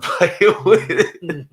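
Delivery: high, loud, words drawn out through laughter; breaking into laughter. Real, not acted. Friend cracking up at his idea of a kid playing, laughing. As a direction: crack up mid-sentence.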